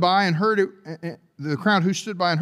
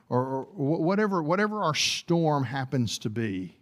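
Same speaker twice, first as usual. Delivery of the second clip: lower, softer pastoral voice; intimate preaching; 4 semitones lower, 4 dB quieter than usual. Acted. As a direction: draw the congregation close.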